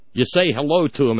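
ordinary talk, nothing marked

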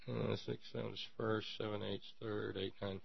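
very soft, under the breath; counting to oneself. Real, not acted. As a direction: mumble through a private calculation. Works out the grade to himself.

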